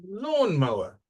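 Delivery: Lawnmower with a high, loud LAWN; Lawnmower, strong start then falling away; stress on first syllable. Shown as a demonstration. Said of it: "First syllables".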